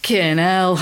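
"Fucking hell" cut down to "kin 'ell", first syllable dropped; swear word half-swallowed. Retold or imitated. Retelling dad's reaction on the phone, "Fucking hell" swallowed to "kin 'ell". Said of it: "You didn't actually say the word".

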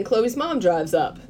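ordinary talk

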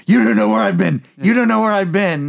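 high, loud imitation of a madman; acted manic shout; crazed taunt. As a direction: yell it like an unhinged, bloodied fighter. Acting the bleeding, crazed fighter's taunt.

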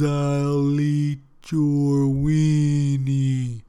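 loud, slow, drawn-out ghostly voice; a spirit intoning from the can, slow and loud; booming ghost. Acted. Ghost speaking from the beans.

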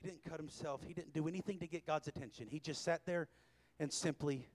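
ordinary talk

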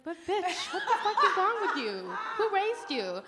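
high, loud, laughing outrage; outraged retort. Retold or imitated. Drunk woman rounds on a stranger, laughing outrage. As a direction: fire it back in disbelief, half laughing. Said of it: "And I was like".

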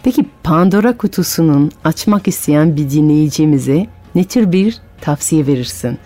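plain reading voice

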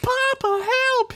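put-on very high little boy yelling; child's cry for help. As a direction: squeal like a small kid. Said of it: "he yelled".

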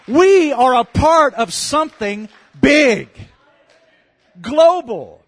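booming sermon emphasis; forceful proclamation. Real, not acted. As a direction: drive it home to the congregation, slowly. Preacher proclaims main point to congregation.

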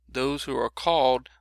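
emphatic, weighty "called" in a verse reading; stress on called. Real, not acted. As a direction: lean hard on the word "called". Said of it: "I kinda emphasize the word called".

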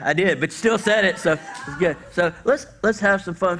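ordinary talk, nothing marked

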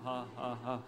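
low, deadpan "Ha, ha, ha" with no joy in it; joyless German laugh. Retold or imitated. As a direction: laugh like it's a duty, flat and dutiful. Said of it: "their happy face is not their happy".

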